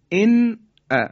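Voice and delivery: ان said with a crisp, fully sounded n before ا; clear izhar. Shown as a demonstration. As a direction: say ان ا high and loud, the n clear and not hummed.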